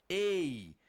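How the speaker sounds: EI drawn out like "ay", falling; vowel sound demo. Shown as a demonstration. Teacher repeating the sign's sound for students, drawn-out vowel. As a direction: hold "ei" long and let it fall. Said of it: "representa o som de".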